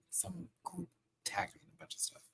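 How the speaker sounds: whispered aside, off mic. Real, not acted. Whispering an aside while checking her phone. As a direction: drop to a whisper while busy. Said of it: "Sorry, I had to".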